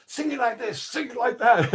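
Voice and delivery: high excited coaching voice. Retold or imitated. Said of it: "Whip was there going".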